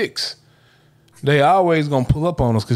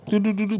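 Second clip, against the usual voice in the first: high, quick "do-do-do" keypad noises; light singsong dialing noises; keypad sounds; 8 semitones higher, 4 dB quieter, faster than usual. Retold or imitated. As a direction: imitate punching in a phone number. Imitates punching in phone number.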